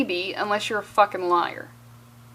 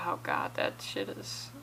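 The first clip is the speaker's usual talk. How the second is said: hushed aside in dread; whisper; 14 dB quieter than usual. Real, not acted. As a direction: breathe it out in a whisper. Dread about student loans.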